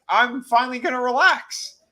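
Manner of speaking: high, loud, pleased announcement; mock contentment. Recapper as man settled on his dream dock, mock delighted.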